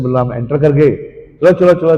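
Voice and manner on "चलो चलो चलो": loud, raised rallying call; eager urging. Acted. Cowherd boys entering the forest, eager. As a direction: get everyone moving.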